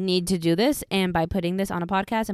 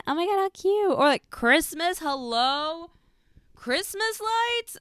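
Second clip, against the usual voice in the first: gushing excitement; 11 semitones higher, slower than usual. Real, not acted. Gushing over holiday dating.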